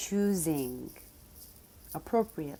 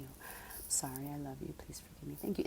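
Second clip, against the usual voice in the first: whispered mantra; 9 dB quieter than usual. Shown as a demonstration. Showing the silent prayer she says inside, whispered. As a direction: barely voice it, as if only in her mind. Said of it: "In my mind, my, my lips are still".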